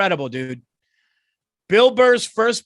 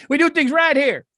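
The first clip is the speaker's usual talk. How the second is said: higher, louder smug coach voice; mock proud coach; 7 semitones higher, 4 dB louder than usual. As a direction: puff up like a losing coach bragging. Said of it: "What, 'cause you're losing?".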